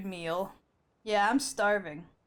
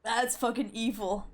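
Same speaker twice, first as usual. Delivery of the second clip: ordinary talk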